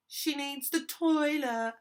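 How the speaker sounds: high, loud, drawn-out mock gasp. A narrator's reading. Giggling girl repeating the excuse to the others, gasping. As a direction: gasp it slowly, stretching out the last word. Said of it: "she gasped".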